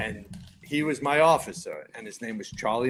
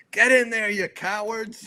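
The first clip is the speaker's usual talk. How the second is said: high, loud rallying shout; mock-heroic holler at the crew; imitated battle yell; 9 semitones higher, 4 dB louder than usual. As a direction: yell it like an officer charging into a fire. Officer yells to crew at fire.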